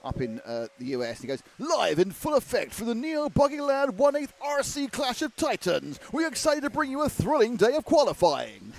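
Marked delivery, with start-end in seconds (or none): 1.6-8.7 booming announcer hype voice; big slow trucker announcer read; monster truck ad. Retold or imitated. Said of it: "pretty close to my monster truck announcer voice".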